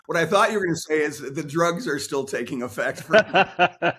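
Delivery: talking through a laugh; chuckling while joking. Real, not acted. Joking about dental drugs.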